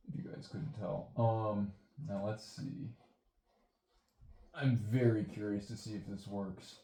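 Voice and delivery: usual speaking voice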